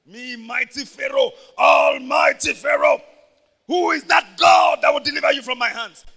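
loud, arrogant king shouting his challenge; boastful Pharaoh. Acted. Preacher voices Pharaoh defying the Israelites, shouting proudly. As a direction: roar it like a tyrant taunting God.

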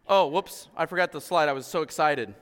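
ordinary talk

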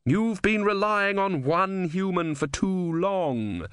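high, slow, lofty reproach; chiding sea goddess. A narrator's reading. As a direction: chide in a high, drawn-out voice. Sea Queen chides Om.

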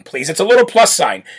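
normal talking voice

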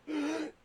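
a sudden high gasp on waking; sharp gasp. Retold or imitated. Waking from the death dream, sharp gasp. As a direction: gasp as if jolted awake. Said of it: "and I said".

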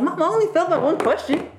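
put-on high, eager kid voice; proud kid. Younger self expecting mom to be proud, excited. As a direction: imitate a child announcing good news to mom.

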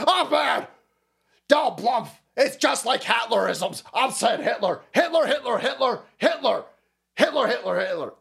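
high, strained mock ranting; hammed-up politician repeating himself in a fit; angry Joe Biden. Host parodying the president's Hitler comparisons, hammed-up angry rant. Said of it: "he's got such angry".

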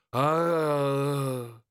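drawn-out mournful troll sigh; a long sorrowful troll ah. Acted. Troll sergeant lamenting to his commander, mournful ah. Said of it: "said Detritus mournfully".

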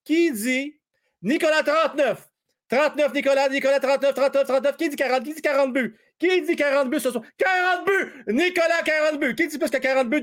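high, rapid-fire bid calls; auctioneer. Pretend. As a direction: rattle off the bids like an auctioneer. Mock auction bidding. (crieur d'encan, voix haute)

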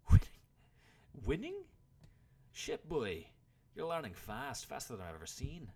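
loud, high incredulous start, then easing a little; old warrior lecturing his pupil; exasperated. A narrator's reading. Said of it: "took on a slightly exasperated expression".

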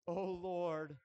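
loud, anguished plea to God; crying out at the end of hope. Acted. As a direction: call out to the Lord in desperation. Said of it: "there's some anguish in that".

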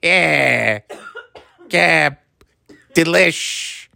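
put-on light Rochester accent, TV chef chirp; a hint of a Rochester accent, upbeat; Rachel Ray Rochester accent. Playing Rachel Ray with a Rochester accent, lightly. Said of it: "That Rochester accent and Detroit".

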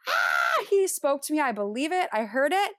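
gasp, then high, loud, thrilled exclamation; amazed excitement. Retold or imitated. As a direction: reenact breathless joy at being spoken to. Reenacts reaction to hearing God.

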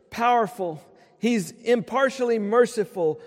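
rhythmic, rising litany of praise; preaching. Acted. Pastor quoting Lockridge's "That's my King" to the congregation, preaching. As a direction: preach it, building line by line.

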